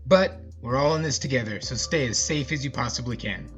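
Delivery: ordinary delivery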